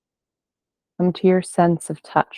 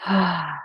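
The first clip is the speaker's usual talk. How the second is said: long open exhale through the mouth; long audible sigh; 5 dB quieter than usual. Shown as a demonstration. Teacher showing listeners a long exhale out through the mouth, audible sigh. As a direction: breathe out in a falling "hah". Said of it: "a long exhale out through the mouth".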